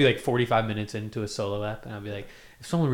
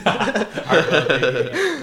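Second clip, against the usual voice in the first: laughing; 7 semitones higher, 8 dB louder, much slower than usual. Real, not acted. Teasing repeat of friend's phrase.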